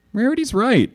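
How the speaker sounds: high, agreeing pony; Dash voice. A narrator's reading.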